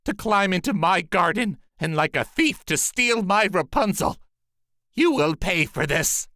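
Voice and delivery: the sorceress scolding a thief; angry sorceress. A narrator's reading. Sorceress threatening the man who stole her Rapunzel, angry. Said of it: "with an angry look".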